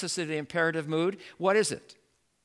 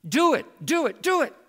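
rising higher and louder "do it"; urging; 10 semitones higher, 5 dB louder than usual. Acted. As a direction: press harder each time.

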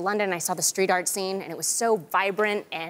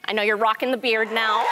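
ordinary talk, nothing marked

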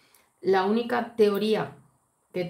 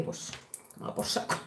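breathless, whispered "toma por saco"; muttered curse; 10 dB quieter than usual. Retold or imitated. Angry empress cursing under her breath, whispered. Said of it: "ella se cabrea y dice".

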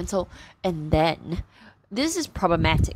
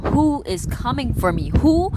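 higher and louder, climbing to a cry on the second who; panicked question; 7 semitones higher, 4 dB louder than usual. Retold or imitated. Husband demanding who is after his family, rising to a cry. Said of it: "our main character is like".

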